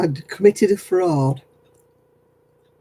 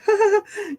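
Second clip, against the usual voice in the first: mock sarcastic laugh.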